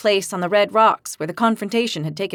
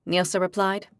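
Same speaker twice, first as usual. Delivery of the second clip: ordinary delivery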